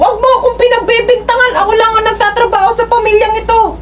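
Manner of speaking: high-pitched, scolding wife voice; resentful wife. Retold or imitated. Wife throwing her sacrifices back at her husband, high and scolding.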